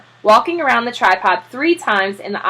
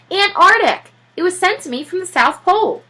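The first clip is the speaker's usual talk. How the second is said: eager explaining; 9 semitones higher than usual. Acted. Mr Popper correcting eagerly.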